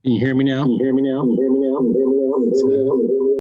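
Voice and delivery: normal talking voice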